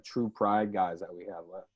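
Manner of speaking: ordinary talk, nothing marked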